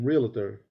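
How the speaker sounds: realtor with an extra vowel, REAL-uh-ter; mispronounced realtor. Shown as a demonstration. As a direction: mimic the wrong realtor, "ree-luh-ter". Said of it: "there's no I in".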